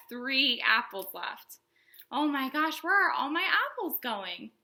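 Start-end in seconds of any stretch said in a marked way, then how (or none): none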